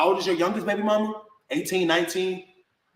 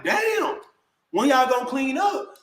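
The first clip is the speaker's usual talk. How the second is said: high-pitched, retold exasperation; exasperated complaint; 6 semitones higher, slower than usual. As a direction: complain in disbelief, pitch jumping up. Retold complaint about a dirty house.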